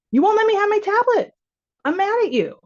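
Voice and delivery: put-on high, loud whiny kid complaint; angry child. Child yells at parent over the tablet, high and loud. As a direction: play a furious little kid denied the tablet.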